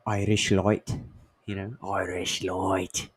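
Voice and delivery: light Irish lilt on Irish Light; Irish accent, lightly done. Retold or imitated. Imitating Irish beer talk.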